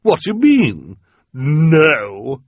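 incredulous villainess; astonished Esme. A narrator's reading. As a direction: demand in disbelief. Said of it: "in an astonished voice".

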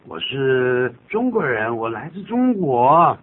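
proud. Shown as a demonstration. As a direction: announce it with pride, drawing it out. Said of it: "带着很骄傲的心情".

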